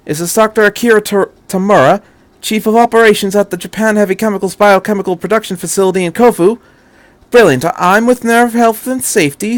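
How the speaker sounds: quick, bright, persuasive caller; the Doctor, brisk and high. A narrator's reading. The Doctor on the phone to a chemicals chief, brisk and urgent. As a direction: talk fast and charming into the phone.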